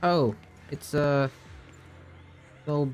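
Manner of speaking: usual speaking voice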